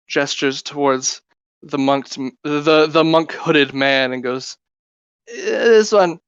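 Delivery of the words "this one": higher little fairy voice. Acted. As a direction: point him out lightly. Fairy points out the monk.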